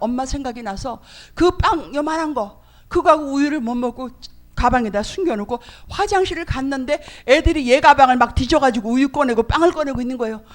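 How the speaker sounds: ordinary delivery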